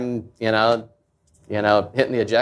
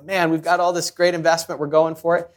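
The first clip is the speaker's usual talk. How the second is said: higher, eager mock team voice; imitating a fired-up team; 7 semitones higher than usual. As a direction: play the pumped-up colleague, lightly. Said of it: "Team's fired up".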